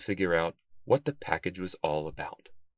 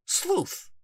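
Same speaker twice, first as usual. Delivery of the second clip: bookworm calling. A narrator's reading. Bookworm character voice.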